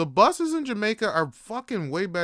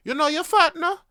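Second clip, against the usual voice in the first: put-on kid's taunt; 12 semitones higher, 7 dB louder than usual. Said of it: "he's like".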